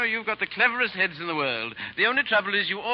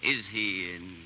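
slow, lightly hammed-up poem recital; reciting verse; 3 semitones lower, slower than usual. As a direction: recite his own verse with mild flourish. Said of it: "I want you to hear my verse".

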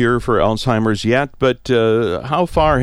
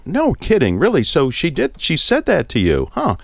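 lightly raised, bright put-on interest; mock fascinated interest; 6 semitones higher than usual. Son humoring his forgetful mom, bright mock interest. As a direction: act amazed to humor her, then breezily change the subject.

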